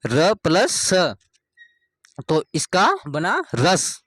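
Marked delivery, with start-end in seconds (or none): 0.1-1.1 slow demo. Shown as a demonstration. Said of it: "teacher spells out letter sum".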